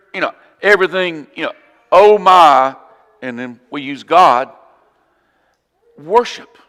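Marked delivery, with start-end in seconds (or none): 1.9-2.8 loud mock exclamation of surprise. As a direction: exclaim it loudly, imitating casual astonishment. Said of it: "I'm surprised, I'm excited, I'm scared".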